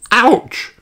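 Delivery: put-on high toddler yelp; little ouch. A narrator's reading. George cries out at the blackberry bush, high. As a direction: yelp as a thorn pricks. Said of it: "cries George".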